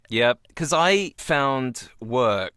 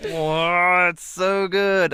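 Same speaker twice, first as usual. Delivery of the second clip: delighted; 7 semitones higher, 5 dB louder, much slower than usual. Real, not acted. Delighted reaction.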